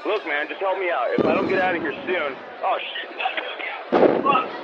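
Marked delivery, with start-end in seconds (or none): none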